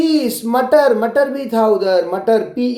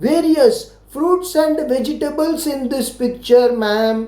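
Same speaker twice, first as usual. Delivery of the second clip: slow pupil's answer to ma'am; slow model answer; 3 semitones higher, much slower than usual. Shown as a demonstration. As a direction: answer slowly and clearly, like a good pupil. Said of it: "तो आप क्या बोलोगे?".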